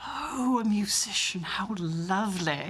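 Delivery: gushing posh West End English; [name]. Acted. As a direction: coo in a posh West End accent, stretching the last words. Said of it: "my posh West End accent".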